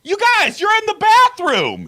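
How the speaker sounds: very high, loud mock holler; retold high-pitched shout; imitated yelling. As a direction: holler it way up high like an excited friend. Imitates friend repeating her yell.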